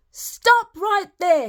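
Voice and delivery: Granny yelling at the wolf. Acted. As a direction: stop him with a shout. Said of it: "yelled Granny".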